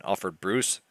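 ordinary talk, nothing marked

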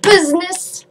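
mock-serious loud. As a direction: shout it playfully. Mock argument over texting.

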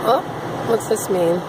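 ordinary talk

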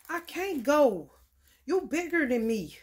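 put-on a whiny kid, pushed out loud at the start; child's complaint. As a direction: speak as a child protesting to a bigger adult. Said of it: "That's what children be wanting to say".

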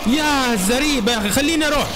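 panicked high yell. Real, not acted. Boxed in by enemies, begging.

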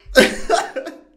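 high breathless burst of laughter; breathy laugh. Real, not acted. Laughing at the rival's weak comeback, a burst of laughter. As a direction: laugh at him out loud.

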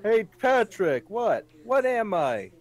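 squeaky put-on Texan voice; mock Texan. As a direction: play a dim cowboy joke. SpongeBob-style Texas bit.

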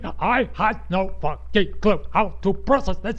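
loud, hammered word by word; angry shouting. Real, not acted. Podcaster at the end of a rant, angry shouting. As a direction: yell it out syllable by syllable.

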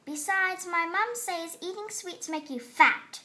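annoyed and sharp; snappish. A narrator's reading. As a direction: keep snapping at her. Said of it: "she snapped back".

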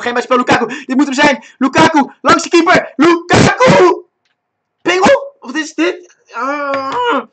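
high, very loud match commentary; excited shouting. Real, not acted. Gamer urging Lukaku past the keeper, shouting wildly. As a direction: yell it as the striker breaks through. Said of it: "ik zal niet zo schreeuwen".

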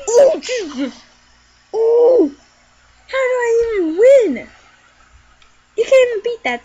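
high loud disbelief; shocked exclaiming. Real, not acted. Hard level.

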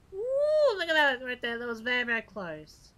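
high whoop settling into loud excitement; whoop, then marvel at the close call; loud excited burst, easing down; thrilled near-miss. Real, not acted.

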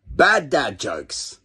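drawn-out, deadpan intro call; slow title announcement. Acted. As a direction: announce it slowly like a show title.

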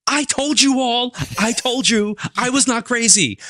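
put-on high-pitched, drawn-out kid's outburst; excited eight-year-old kid. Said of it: "an eight-year-old kid inside me who".

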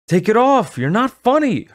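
high, loud angry shout. Acted. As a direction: yell at her to stop. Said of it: "her brother shouted".

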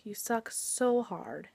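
ordinary talk

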